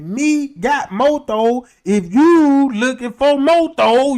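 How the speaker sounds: very slow and loud, spelling it out; overenunciate, loud and slow, pointing at yourself; slow exaggerated talk to a foreigner. Offering weed to the Spanish neighbor, slow loud overenunciation. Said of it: "I start pointing at me like".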